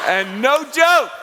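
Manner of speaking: loud, slow laughing burst; laughing shout. Real, not acted. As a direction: crack up as you say it. Laughing at the memory.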